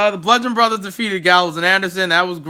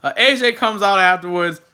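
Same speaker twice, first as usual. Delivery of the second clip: usual speaking voice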